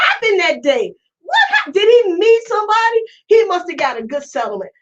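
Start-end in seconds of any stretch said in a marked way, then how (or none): 1.2-3.0 high shout of astonishment. Real, not acted. Wondering what changed Robinson.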